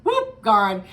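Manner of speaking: giddy high outburst; excited. Real, not acted. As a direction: blurt it out in delight. Laughing, excited retelling.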